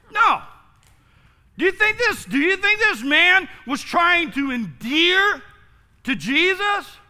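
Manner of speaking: usual speaking voice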